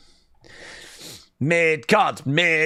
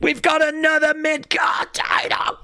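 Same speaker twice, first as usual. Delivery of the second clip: ecstatic celebration; 11 semitones higher than usual. Real, not acted. Wrestling fan screaming for Sammy's title win, ecstatic. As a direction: yell it like your guy just won the belt.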